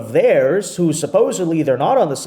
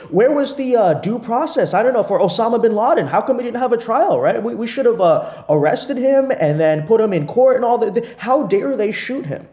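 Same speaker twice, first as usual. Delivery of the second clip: higher, mock-earnest outrage; sarcastic parody; 7 semitones higher than usual. Host mocking critics over bin Laden, higher sarcastic tone. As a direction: pretend to argue the other side, dripping sarcasm.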